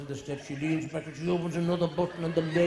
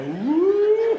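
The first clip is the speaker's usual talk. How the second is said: high loud delighted whoop; mock thrilled woo; whooping cry; 9 dB louder than usual. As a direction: let out a giddy squeal. Women lifted onto the car.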